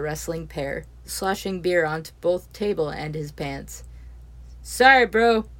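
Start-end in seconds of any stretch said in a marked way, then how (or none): 4.6-5.5 rowdy apology. Acted. Neil rowdy mid-wrestle.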